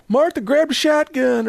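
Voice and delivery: hammed-up Southern call to the wife; funny, silly Southern accent. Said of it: "I use a Southern accent".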